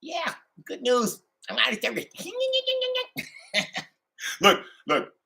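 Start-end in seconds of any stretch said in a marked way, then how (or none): 2.1-3.3 a high, breathy giggle of glee. Real, not acted. Laughing at the bosses, a real high giggle. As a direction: giggle up high at your own joke. Laughing at his own taunt.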